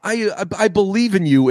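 overeager, high and earnest; eager helper voice. Acted. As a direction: play a keen little helper character. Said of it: "the guy that's tripping over himself".

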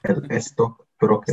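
put-on slow Spanish-accented El stockbroker, as es-tock-broker; mock Spanish accent. Answering how to say it in Spanish, mock Spanish accent. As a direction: fake the Spanish word with a Spanish accent.

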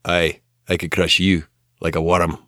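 a light mock Scottish accent, like a Braveheart warrior; a hint of Scottish brogue, playful threat.

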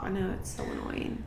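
breathy whispered aside. Real, not acted. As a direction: say it under your breath, embarrassed. Sighs at own baby talk.

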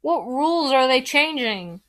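loud, urgent question; calling out. A narrator's reading. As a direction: call after someone moving away. Shouting after departing family.

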